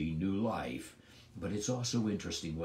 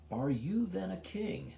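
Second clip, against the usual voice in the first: perplexed; 9 semitones higher than usual. Acted. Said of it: "And now he's perplexed".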